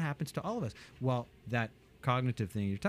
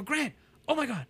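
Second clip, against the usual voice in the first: much higher, fast, eager sales pitch; passionate excitement; 4 semitones higher, faster than usual. Retold or imitated. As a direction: sell it bursting with excitement. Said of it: "I get into passionate excitement".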